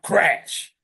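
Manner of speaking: usual speaking voice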